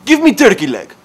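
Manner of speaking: loud, high mock-Russian demand; Russian accent. Customer demanding food from the counter lady, yelled Russian accent. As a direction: shout it in a thick Russian accent. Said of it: "Russian trash".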